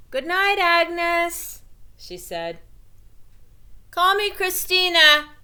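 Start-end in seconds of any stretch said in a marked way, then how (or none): 0.1-1.6 much higher, loud and slow call; called from down the hall. A narrator's reading. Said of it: "called from down the hall". 3.9-5.3 much higher and loud defiant shout; shouted back. A narrator's reading. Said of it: "Agnes shouted back".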